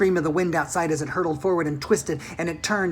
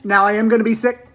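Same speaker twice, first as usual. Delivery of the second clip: yelled; 5 semitones higher, 8 dB louder than usual. A narrator's reading. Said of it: "yelled Missus T V".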